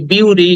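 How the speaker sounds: "বিউটি" with a byu glide at the start; the mistaken "বিউটি", byu-ree; wrong pronunciation. Shown as a demonstration. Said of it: "কিন্তু উচ্চারণটা হবে".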